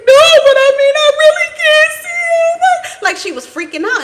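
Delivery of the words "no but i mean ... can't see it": put-on very high, loud child voice; panicked child. Granddaughter freaking out to Grandma, high loud squeal. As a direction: squeal it like a scared little girl. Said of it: "and she was like".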